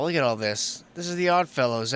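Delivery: ordinary talk, nothing marked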